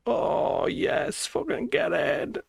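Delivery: breathy whispered yes of triumph; whispered win. Real, not acted. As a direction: celebrate under the breath. Streamer celebrates it working.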